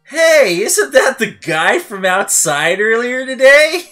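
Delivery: loud, high, drawn-out question; hammed-up disbelieving holler; astonished recognition. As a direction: call it out in amazement, rising to the end. Retelling own amazed recognition.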